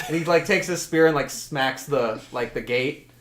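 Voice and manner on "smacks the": ordinary talk, nothing marked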